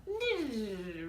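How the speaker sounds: vocal tape rewind noise. Pretend. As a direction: make a whirring rewind sound. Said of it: "That's a good rewinding sound".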